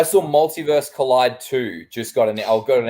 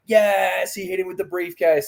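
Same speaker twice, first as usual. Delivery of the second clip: mock crowd cheer; 7 semitones higher than usual. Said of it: "people were like".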